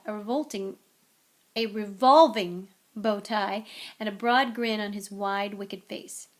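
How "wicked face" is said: ordinary delivery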